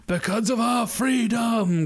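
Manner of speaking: drawn-out, high heroic cry; hammed-up rallying call to the troops; mock Braveheart speech. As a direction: parody a rousing freedom speech, slowly. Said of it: "He's straight up doing Braveheart".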